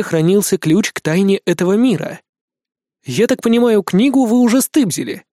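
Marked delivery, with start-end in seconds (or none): 3.0-5.2 higher, accusing tone. A narrator's reading. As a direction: catch the thief out. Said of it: "обличил воришку Никитка".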